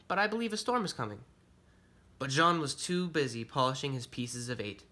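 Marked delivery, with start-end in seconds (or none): 0.1-1.2 quick, high-pitched warning; polite young bunny. Acted. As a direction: hurry out the warning in a higher voice. Timid bunny warns busy pirate.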